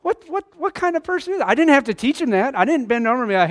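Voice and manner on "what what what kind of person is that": high, incredulous aside to his wife; mock disbelief. Father to wife, high mock disbelief. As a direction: sound amazed at the kid's nerve. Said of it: "I looked at Sarah and I was like".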